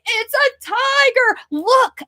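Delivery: high, slow delighted reveal; excited reveal. Shown as a demonstration. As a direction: show off the tiger gleefully. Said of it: "I'm gonna say".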